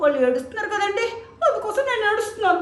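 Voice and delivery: put-on a naive boy explains he cries because the others cry; silly little disciple. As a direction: blurt out the foolish reason. Silly boy explains his crying.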